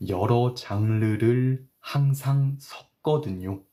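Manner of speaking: slow, careful read of 여러 장르를 항상 섞거든요. Shown as a demonstration. Teacher reads the example sentence slowly for students. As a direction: spell it out slowly for learners. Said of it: "천천히 읽을게요".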